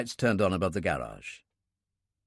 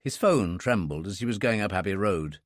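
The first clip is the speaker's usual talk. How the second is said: usual speaking voice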